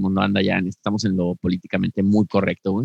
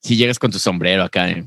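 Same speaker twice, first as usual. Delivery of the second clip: usual speaking voice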